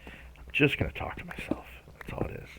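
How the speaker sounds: a quiet voiceless confession; whisper. Pretend. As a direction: whisper it like a secret decision. Whispered decision to talk to himself.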